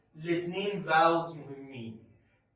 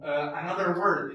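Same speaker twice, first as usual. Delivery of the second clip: ordinary talk, nothing marked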